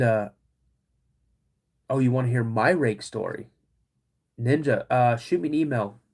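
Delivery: normal talking voice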